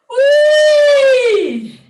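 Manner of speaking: high loud siren whoop; joyful loud wee; playful whee. Shown as a demonstration. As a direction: swoop up like a siren, full voice. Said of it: "Let it be a playful sound".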